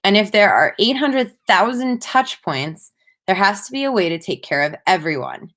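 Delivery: usual speaking voice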